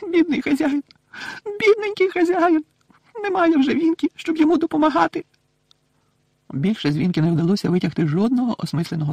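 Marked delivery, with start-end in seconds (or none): 0.0-5.2 very high plaintive whimper; weepy little servant mourning her master; pitifully sobbing elf. A narrator's reading. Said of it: "жалібно хлипає".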